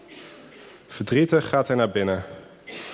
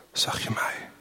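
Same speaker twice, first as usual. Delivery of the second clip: breathy hushed whisper; 6 dB quieter than usual. A narrator's reading. Visitors in the room whisper to the shoemaker. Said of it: "zeggen ze zacht".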